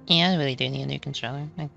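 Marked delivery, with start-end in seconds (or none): none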